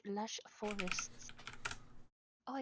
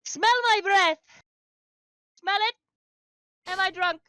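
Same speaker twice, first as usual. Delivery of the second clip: frantic shout; 10 semitones higher, 17 dB louder than usual. Acted. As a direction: yell it right in his face, high and loud. Character shouting at vendor.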